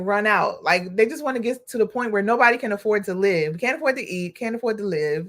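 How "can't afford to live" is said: ordinary talk, nothing marked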